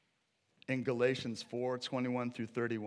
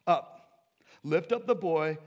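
loud, raised scriptural command; commanding angel; 5 semitones higher, 9 dB louder, slower than usual. Acted. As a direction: call it down as an urgent order. Angel commands Hagar from heaven.

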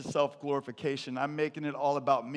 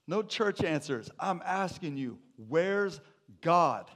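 rising higher and louder to a loud "God?"; building demand; 4 semitones higher, 3 dB louder, slower than usual. Retold or imitated. Principal demands of the scared boy where God is. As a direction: push harder on each word until you bark "God?".

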